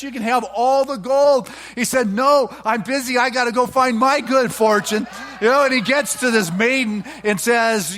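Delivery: loud, high preaching, building as the tale runs on; fiery sermon storytelling. Acted. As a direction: preach the parable at full force to the congregation.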